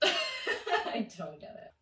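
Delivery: slow, high laugh through the words; baffled laughing. Real, not acted. Narrator amused by own dream, laughing bafflement. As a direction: laugh helplessly as you say it.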